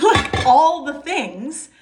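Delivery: laughing, slow and high. Real, not acted. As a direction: laugh through the words. Laughing at her own list.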